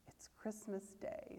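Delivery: soft whisper, slow and amazed; to himself. A narrator's reading. Scrooge whispering to himself in hushed wonder. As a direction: breathe it to yourself in wonder. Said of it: "said Scrooge to himself".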